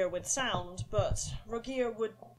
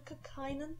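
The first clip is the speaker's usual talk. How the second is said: soft, unsure stammered question; nervous stammer; 6 semitones higher, 5 dB quieter than usual. Acted. Young Kynan asking his own name nervously. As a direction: trip on the name, a little scared. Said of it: "sound young and slightly nervous".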